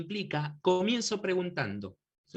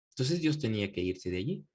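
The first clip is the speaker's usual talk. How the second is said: ordinary talk, nothing marked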